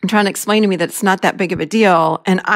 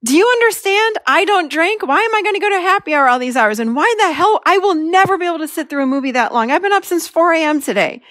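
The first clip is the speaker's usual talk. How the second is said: higher, pressing rant; insisting, voice pitched up with anger; growing anger; 8 semitones higher than usual. Retold or imitated. Venting to her husband, higher indignant rant. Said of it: "more and more angry".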